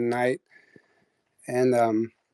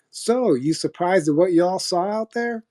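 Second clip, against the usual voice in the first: higher, slow country drawl; country accent; 6 semitones higher than usual. Retold or imitated. New nurse walking in the room, country accent. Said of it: "In, like, this country accent".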